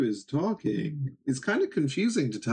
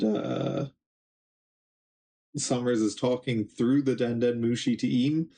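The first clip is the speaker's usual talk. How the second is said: ordinary talk, nothing marked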